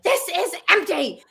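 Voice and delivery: high exasperated snap; angry outburst. Retold or imitated. Mom at her husband over empty gum, high angry snap. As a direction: spit it out in disbelief, high. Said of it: "I'm like".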